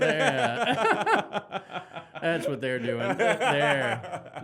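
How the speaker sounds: breaking up with laughter mid-sentence; giggling while trying to talk; laughing. Real, not acted. Laughing at own joke.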